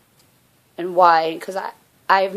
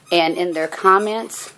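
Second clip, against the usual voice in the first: usual speaking voice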